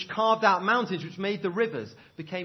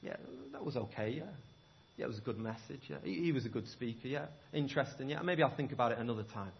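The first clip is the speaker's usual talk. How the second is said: offhand shrug; 6 semitones lower, 9 dB quieter, faster than usual. Retold or imitated. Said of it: "where you go".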